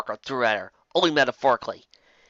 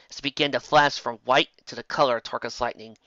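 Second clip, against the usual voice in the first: ordinary delivery, nothing marked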